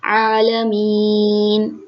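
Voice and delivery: आलमीन with ain pushed from the throat, long ी; slow आलमीन, throaty ain. Shown as a demonstration. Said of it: "तीन अलिफ के बराबर खींचते हैं".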